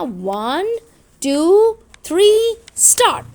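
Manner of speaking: loud, high countdown, a gap after each word; race starter. Acted. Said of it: "whistle के लिए यहाँ पे बंदा खड़ा होगा". (दौड़ शुरू कराने वाली ऊँची पुकार)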